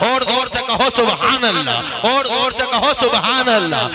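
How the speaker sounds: loud, ringing sermon praise; full-force devotional oratory; fiery preaching. Acted. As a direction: preach it out to the crowd. Preaching climax to the crowd.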